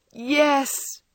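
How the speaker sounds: high, loud sobbing yes; despairing sob. A narrator's reading. Wife sobbing her answer to her husband, despairing. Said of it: "sobbed Meg despairingly".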